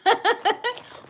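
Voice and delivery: short, high burst of giggles; real giggle. Real, not acted. Vlogger laughing after musing about earrings, high giggle. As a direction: giggle lightly at your own remark.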